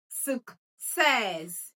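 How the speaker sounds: "success" drawn out as suk... SESS; stress on second syllable. Shown as a demonstration. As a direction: lead a class repeat of "success", long SESS. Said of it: "let's pronounce it together".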